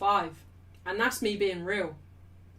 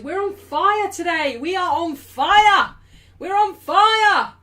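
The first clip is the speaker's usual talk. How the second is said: loud, high, stretched-out cheer; excited hype; 8 semitones higher, 10 dB louder, slower than usual. Real, not acted. Streamer telling viewers the super chats are flying, excited hype. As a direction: build it bigger with each repeat.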